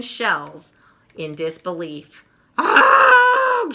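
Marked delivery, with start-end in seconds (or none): 2.6-3.7 high, loud cry of dismay; shocked cry. Acted. Peppermint Patty seeing Marcy fried the eggs, high loud cry. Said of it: "she cries".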